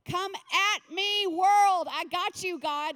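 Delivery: loud, high, drawn-out battle cry; defiant challenge. Acted. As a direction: call out slowly and loudly, daring all comers. Acted challenge to the world.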